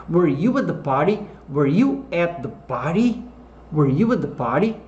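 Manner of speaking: "Were you at the party" with a questioning rise on "party"; rising question tune. Shown as a demonstration. Teacher models a question for learners, rising at the end of "party". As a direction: lift the pitch at the end of "party".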